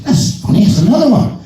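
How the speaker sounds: high, puzzled voice a good deal above his own; high-pitched bewildered drinker; surprised Irishman. Retold or imitated. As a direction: squeak it out, baffled. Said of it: "And he says".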